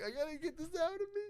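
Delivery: high, fast imitation of panic; retold desperation. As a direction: act out an urgent squeal to get sick. Retold desperate urge to throw up.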